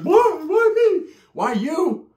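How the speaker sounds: high wailing why me; high whiny movie quote; Don't Be a Menace whine. Retold or imitated. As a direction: wail it high and loud through a laugh. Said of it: "doing the fucking tray from Don't Be a Menace".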